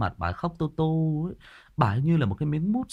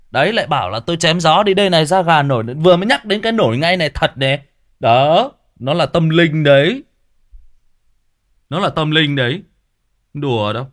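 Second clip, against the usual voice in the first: louder, fast, insisting it is real; excited loud insistence; 10 dB louder than usual. Real, not acted. Vlogger loudly insisting the goosebumps are real, excited. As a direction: swear to the viewers it's true, loudly.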